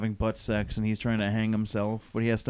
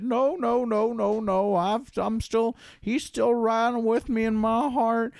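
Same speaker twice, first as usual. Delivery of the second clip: mock pious protest; 8 semitones higher than usual. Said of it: "And I said".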